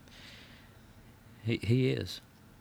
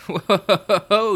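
whoa; 13 semitones higher, 10 dB louder than usual. Acted. Startled at forgetting segment.

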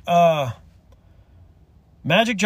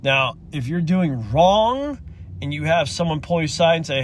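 normal talking voice

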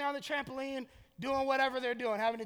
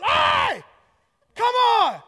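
full-throated, drawn-out high shout down the street; screaming dad; 13 semitones higher, 14 dB louder, much slower than usual. Retold or imitated. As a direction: holler for your kid at the top of your lungs. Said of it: "screaming dad voice".

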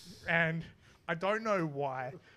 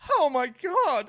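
loud, high mock cry of pleasure; hammed-up neighbour's raucous moan; 12 semitones higher, 14 dB louder, much slower than usual. Imitating the neighbours heard through the wall, raucous moan. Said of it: "just this raucous".

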